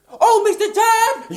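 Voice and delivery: calling out. Acted. Calling out to Todd.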